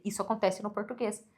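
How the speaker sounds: usual speaking voice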